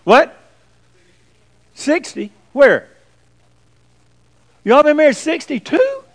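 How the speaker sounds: loud, high-pitched disbelief; astonished. Real, not acted. Preacher hearing of a sixty-two year marriage, astonished. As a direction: burst out in incredulous questions.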